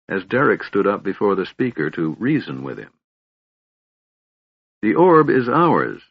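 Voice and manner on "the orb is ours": cold, slow, louder statement; coldly. A narrator's reading. Derek confronting the elf speaker, cold. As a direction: lay it down like iron. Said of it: "Derek stated coldly".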